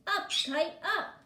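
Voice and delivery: high, loud cheering shout of up kite up. Acted. Toad shouting at his kite to fly, loud and high. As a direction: yell it up to the sky, jumping. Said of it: "jumped up and down and shouted".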